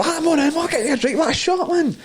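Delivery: very high, excited party chatter; mock matey gushing. Clubber making pals in the smoking area, high gushing mock voice. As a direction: gush at new pals in a squeaky mock voice. Acting matey chat among smokers.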